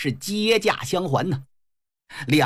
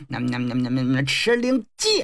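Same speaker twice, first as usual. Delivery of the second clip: muttered spell; 6 semitones lower than usual. Acted. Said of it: "念动仙言咒语".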